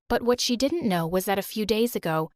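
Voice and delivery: ordinary talk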